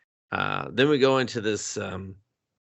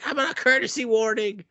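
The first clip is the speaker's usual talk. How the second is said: very high, quick mock outrage; indignant complaint; 13 semitones higher, faster than usual. Man scalded by the flush, high fast outrage. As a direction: squawk it indignantly. Voicing character.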